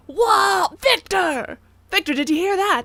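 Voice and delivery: loud, high amazed shout; excited calling out. Real, not acted. Streamer relaying birthday blessing to Victor, loudly excited. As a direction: call to Victor in big amazement.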